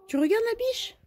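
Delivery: pet talk. Real, not acted. Asking her hen about the deer in a very high baby voice. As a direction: ask a pet a question sweetly.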